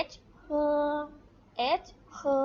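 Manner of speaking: letter H, then เฮอ held long and level; demonstrating a pinyin sound. Shown as a demonstration. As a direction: model เฮอ slowly after naming the letter. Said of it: "ออกเสียงว่าอะไรคะ".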